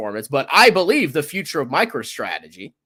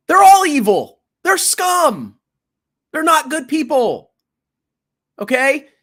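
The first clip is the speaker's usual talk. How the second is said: angry rant; 11 semitones higher, 4 dB louder, slower than usual. Real, not acted. Ranting about grifter being evil.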